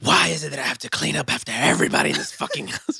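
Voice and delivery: high, fast, exasperated mom rant; mom impression. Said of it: "She turns to Batman".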